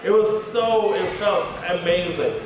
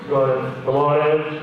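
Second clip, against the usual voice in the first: curt official at the interview; no-nonsense officer voice; stern interrogator; 5 semitones lower than usual. Retold or imitated. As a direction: summon them like an interrogator. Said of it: "the tone became immediately different".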